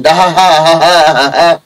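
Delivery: slow ডাহা হা হা হা হা হা, stretched like a laugh; mock pronunciation. Shown as a demonstration. Teacher showing how doctors' wrong abbreviation sounds, slow and mocking. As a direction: read the visarga as a slow mocking ha ha. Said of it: "এটার উচ্চারণ কি জানেন?".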